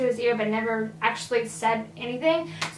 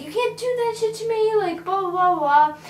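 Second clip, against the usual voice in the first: higher pitched mock yell at the man; imitating angry woman; 7 semitones higher, 3 dB louder than usual. Woman in the car yelling at her man, mock angry. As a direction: play the angry girlfriend, voice up high. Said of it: "I do recall her saying".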